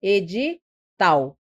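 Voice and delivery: edital drawn out, tal on its own; slow edi, pause, tal; last syllable singled out. Shown as a demonstration. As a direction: separate the final tal of edital. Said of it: "A mais forte é tal".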